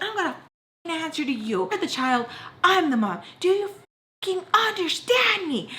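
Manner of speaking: high-pitched re-enactment of a mother laying down the law; furious mom. Retold or imitated. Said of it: "She's like".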